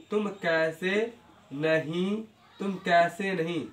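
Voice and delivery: slow dictation. Shown as a demonstration. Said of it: "teacher drills slowly".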